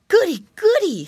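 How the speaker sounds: high, loud piggy squeal; excited squeal. A narrator's reading. Piggy boy delighted at roast chestnuts, excited squeal. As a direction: squeal with delight like a little piglet. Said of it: "squealed Curly".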